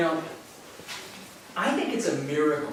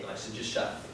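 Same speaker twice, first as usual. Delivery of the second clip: dismissive aside; 6 semitones lower, 6 dB quieter, much faster than usual. Retold or imitated. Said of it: "just not okay".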